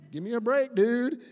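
put-on a kid groaning give me a break at dad; kid's exasperated whine. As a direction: whine it like a fed-up child. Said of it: "my kids who kinda hate me".

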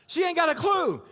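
loud, high exclamation; punchy preacher shout; emphatic shout. Real, not acted. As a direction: call it out to the room. Pastor exclaims about the woman.

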